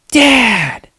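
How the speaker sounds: high loud gasp then Dad; sharp gasp, high shout of Dad; toddler gasp and shout. Retold or imitated. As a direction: imitate an excited toddler. Dad imitating toddler's excited greeting.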